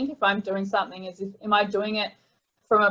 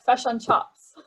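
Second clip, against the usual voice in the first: New Zealand accent, "fish" toward "fush" and chips as "chops"; Kiwi accent; 3 semitones higher, slower than usual. Shown as a demonstration. Guest imitating Kiwi friends for hosts, New Zealand accent. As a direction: mimic a Kiwi, flattening the short "i" in "Fish and chops". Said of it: "but if you're a Kiwi".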